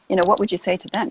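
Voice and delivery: ordinary talk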